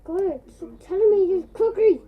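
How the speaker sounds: loud high annoyed outburst; exasperated yell. Real, not acted. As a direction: snap at the screen in exasperation.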